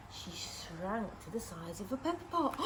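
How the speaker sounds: ordinary delivery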